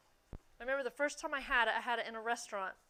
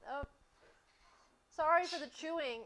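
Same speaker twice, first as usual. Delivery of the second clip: ordinary delivery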